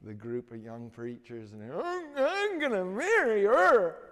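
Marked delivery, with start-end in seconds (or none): 1.8-4.0 high, loud stammer catching on every sound; stuttering. Retold or imitated. A mock stutter for the preacher boy telling his dream. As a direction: push out each broken syllable, high and strained. Johnny's daily vow.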